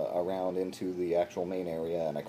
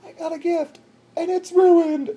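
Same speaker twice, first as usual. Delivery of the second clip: much higher, louder wail of disappointment; hammed-up heartbroken friend; crushed lifeguard friend; 14 dB louder than usual. Lifeguard friend seeing her ruined gift, mock-dismayed wail. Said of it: "this look on her face of".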